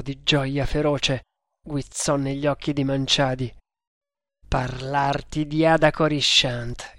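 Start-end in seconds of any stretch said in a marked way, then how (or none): none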